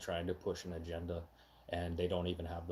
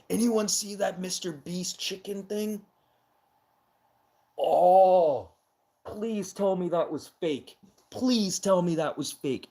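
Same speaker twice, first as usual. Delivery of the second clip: raised high and loud, disgusted; loud outraged exclaiming; 9 semitones higher, 7 dB louder than usual. Real, not acted. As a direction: blurt it out loud in disbelief. Ranting about Mister Beast chicken video.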